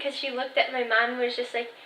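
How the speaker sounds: normal talking voice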